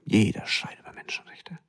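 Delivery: hushed, breathy whisper of the same line. Shown as a demonstration. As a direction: drop to a whisper on the repeat. Repeats it, dropping to whisper.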